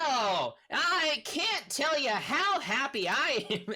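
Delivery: high, slow, drawn-out gushing thanks; effusively thankful captive. Acted. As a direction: gush with hammed-up gratitude. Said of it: "continuing to just be thankful".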